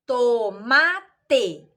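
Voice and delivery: "tomate" in three separate beats, to-ma-te; syllable by syllable. Shown as a demonstration. Teacher showing one letter per sound, "tomate" split to-ma-te. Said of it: "uma letra pra cada emissão sonora".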